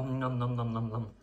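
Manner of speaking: chewing while saying nom nom; mouth full. Real, not acted. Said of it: "shouldn't speak with your mouth full".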